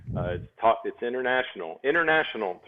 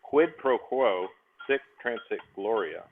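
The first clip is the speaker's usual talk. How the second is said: slow recitation; slower than usual. Shown as a demonstration. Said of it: "This is all the Latin I know".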